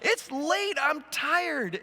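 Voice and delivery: playful tired whine about the late hour; whiny complaint. Retold or imitated. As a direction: whine it like a worn-out traveler. Whining after exasperated sigh.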